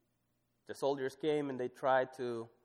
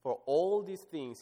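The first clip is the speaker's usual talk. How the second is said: high, slow, stress on all; scripture reading; 6 semitones higher, 5 dB louder than usual. Acted. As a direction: weigh every word. Reads scripture aloud.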